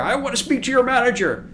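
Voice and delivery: mock an entitled customer demanding the boss; Karen impression. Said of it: "Karen videos or Kyle videos".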